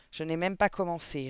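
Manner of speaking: usual speaking voice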